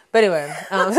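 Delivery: laughing, back to her own voice; laughing switch back. Real, not acted. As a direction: drop the accent with a laugh. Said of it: "see, I switched up there real quick".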